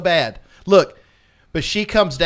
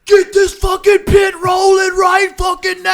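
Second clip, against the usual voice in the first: much higher, loud, slow metal roar; mock metal scream; 9 dB louder, slower than usual. Retelling her command to the crowd, screamed metal-style. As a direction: yell it like a frontwoman firing up the pit. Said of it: "she came out and was like".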